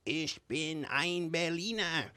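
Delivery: put-on stretched-out New England speech-maker accent; JFK. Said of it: "in his New England accent".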